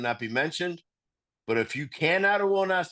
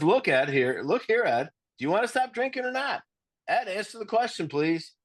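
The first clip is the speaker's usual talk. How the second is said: 1930s movie guy voice; 6 semitones higher than usual. Retold or imitated. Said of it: "go into nineteen thirties movie guy voice".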